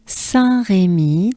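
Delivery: slow "Saint-Rémy" in French; French Saint-Rémy with throaty R and held ending; French name demo. Shown as a demonstration. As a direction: stretch Saint-Rémy for learners. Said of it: "So we have Saint-Rémy".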